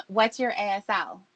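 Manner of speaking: usual speaking voice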